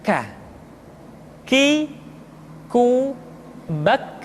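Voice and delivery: "K. Ki, ku, bak" as aspirated kaf syllables; "K. Ki, ku, bak" spoken apart, airy k; breath-flowing k. Shown as a demonstration. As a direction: let breath flow after each k in "K. Ki, ku, bak". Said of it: "Diucapkan dengan mengalirkan nafas kita".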